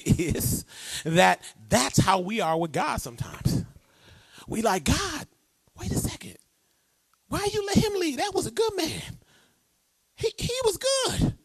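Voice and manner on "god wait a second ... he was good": mock whining believer. Preacher voicing a complaining believer.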